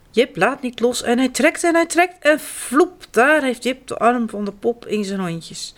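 ordinary delivery

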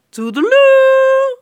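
very high, very loud, slow sing-song goodbye; cheeky farewell. A narrator's reading. Robin taunting prince John as he leaves, very high and loud. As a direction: trill it out mockingly.

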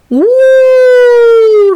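high, loud joyful woe; excited cry. Acted. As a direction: burst out at the sight of presents. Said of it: "roept Kas".

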